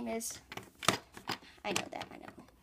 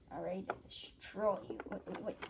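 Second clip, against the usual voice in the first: ordinary delivery, nothing marked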